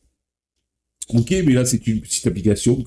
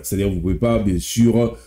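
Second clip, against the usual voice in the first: ordinary talk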